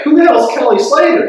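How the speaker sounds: high, loud baffled question; hammed-up bewildered retort; clueless disbelief. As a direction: throw it back loud and high. Acts out his clueless reply.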